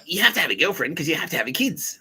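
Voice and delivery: high, insistent mock nag; mock nagging. Friends insisting on a girlfriend, mock nagging. Retells people nagging about dating.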